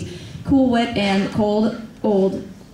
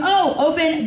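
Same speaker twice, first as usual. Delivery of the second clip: usual speaking voice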